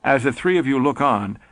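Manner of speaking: usual speaking voice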